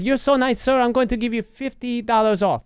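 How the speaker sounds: put-on high, sweet Filipino accent offering a discount; Filipino accent impression. Filipino agent rewarding the nice caller, in a high Filipino accent. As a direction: imitate the agent's delighted Filipino lilt. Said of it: "an impression of a Filipino lady".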